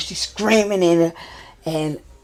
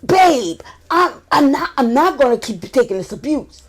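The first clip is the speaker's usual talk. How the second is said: fed-up husband; 10 semitones higher, 7 dB louder than usual. Retold or imitated.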